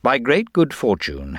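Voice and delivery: ordinary delivery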